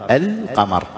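«القمر» as al-qamar with a distinct l; clear lam. Shown as a demonstration. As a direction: sound the l fully in «القمر». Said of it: "اللام تكون مظهرة". («القمر» بإظهار اللام)

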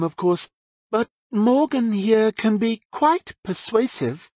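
a hint of a witch talking down to a silly boy; patronising witch. A narrator's reading. Judith explains Morgan's killings.

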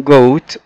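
goat said clearly with a hard g; teacher models goat; model pronunciation. Shown as a demonstration. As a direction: goat carefully enunciated. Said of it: "مرة كمان".

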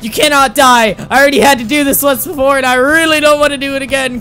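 fast frantic plea. Real, not acted. Gamer frantically pleading with his dying patient, fast and loud. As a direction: beg him fast not to die.

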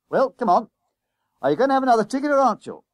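loud, high, rapid demand; impatient bus conductor. Acted. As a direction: hurry the passenger along at full voice.

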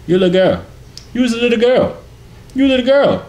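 teasing, climbing into a loud falsetto; mock squeal. Telling someone you a little girl, high mocking squeal.

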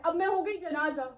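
usual speaking voice

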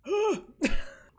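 sharp, high, loud gasp; startled gasp. Real, not acted. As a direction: jump at a scare. Startled while playing horror game.

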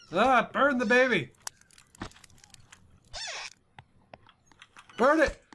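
high, loud and eager; excited. Real, not acted. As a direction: yell it with glee. Burning the doll excitedly.

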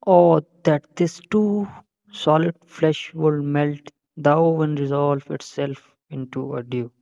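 slow, emphatic stage recitation; reciting Hamlet. Acted. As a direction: open with a loud, drawn-out lament. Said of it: "तो शुरू करें फिर Hamlet की first soliloquy".

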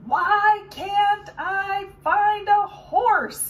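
slow loud frustrated yell. Acted. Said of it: "he yelled".

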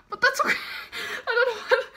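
high, loud laugh-crying; laughing through tears. Real, not acted. Fan laugh-cries over her band's postcard. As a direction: giggle while tearing up.

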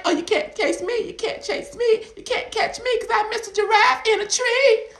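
high, loud teasing giraffe voice; sing-song teasing giraffe; cheeky giraffe. Acted. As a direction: taunt like a playful giraffe in a tree. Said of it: "it's like".

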